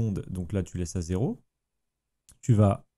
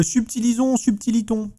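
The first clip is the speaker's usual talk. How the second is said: high, louder mock-grand call; playful flourish; 13 semitones higher, 4 dB louder than usual. Streamer rallying viewers to tackle the small settings, playful and high. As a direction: play with the words in a raised, put-on voice.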